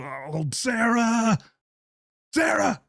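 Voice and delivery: high, drawn-out mock cry of Sarah; mock wail. Mocking the father's pleading to Sarah, a high drawn-out wail. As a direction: wail the name like a melodrama.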